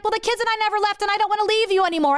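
high, rushed pleading; mock pleading husband. Husband telling his wife he never left, high rushed plea. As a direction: imitate a husband gabbling his excuse.

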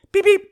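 high electronic beep-beep; imitated keypad beeps.

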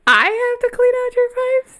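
high, loud laughing question; laughing disbelief. Real, not acted.

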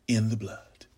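whispered close to the mic; hushed. Acted. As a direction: let it fall to a whisper. Whispered end of refrain.

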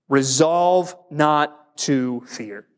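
slow, deliberate dictation; slow emphatic. Shown as a demonstration. Pastor dictating a point to the congregation, slow and deliberate. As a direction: spell out the point slowly for note-taking. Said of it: "let's write it down like this".

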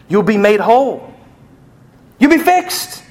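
building from high to a high shout; triumphant promise. Acted. God promising healing to the sufferer, triumphant cry. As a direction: preach the promise, climbing sharply in pitch. God's promise preached to a climax.

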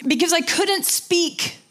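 real choked-up telling, high and halting; emotional. Real, not acted. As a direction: struggle through a painful memory with long pauses. Moved recalling friend's frightening scan.

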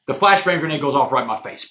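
ordinary talk, nothing marked

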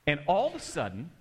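usual speaking voice

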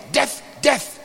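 ordinary talk

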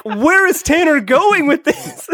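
high, slow, incredulous question; baffled disbelief. Retold or imitated. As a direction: stretch it out, puzzled and amused. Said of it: "And I was like".

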